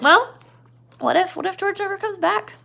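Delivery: high teasing voice; Crooks taunting. Retold or imitated. Crooks taunting Lenny, high and teasing. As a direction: lift the voice to needle him. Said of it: "He's like".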